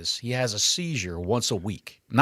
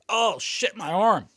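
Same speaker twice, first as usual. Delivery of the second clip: mock pain; 7 semitones higher, slower than usual. Acting out an arm-bar victim, not hurt.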